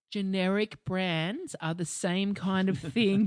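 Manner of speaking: slow, drawn-out shop-assistant line; slow condescending chemist voice; slow patronising explanation. Retold or imitated. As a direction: spell it out slowly as if to a child. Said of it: "she goes".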